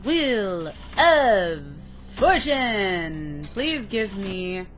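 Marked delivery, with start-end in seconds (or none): 0.0-3.4 loud announcer call; game show announcer. Acted. Announcing the card.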